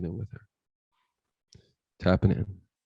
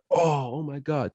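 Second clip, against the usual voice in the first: high, slow imitated moan of shock; horrified onlookers; 6 semitones higher, 4 dB quieter than usual. Everybody turning away from the body, imitated horror.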